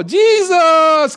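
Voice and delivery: loud, high cry of a blind beggar; desperate shout. Retold or imitated. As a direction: shout his name across the crowd, high and loud. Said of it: "he shouted all the more".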